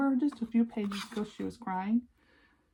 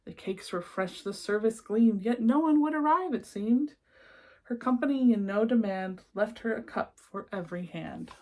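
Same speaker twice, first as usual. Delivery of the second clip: lilting rhymed read-aloud; storybook narration; 3 dB louder than usual. A narrator's reading. Reading a rhyming picture book to children, lilting storyteller voice. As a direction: read the verses like a bedtime book.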